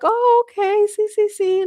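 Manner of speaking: mock eager yes. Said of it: "They're like".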